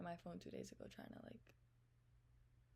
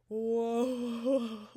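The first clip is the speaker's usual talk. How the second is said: loud drawn-out shocked whoa; 5 semitones higher, 17 dB louder than usual. Retold or imitated. Reading his shocking message, loud stunned whoa.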